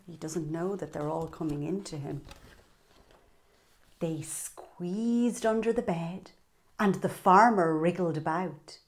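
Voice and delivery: usual speaking voice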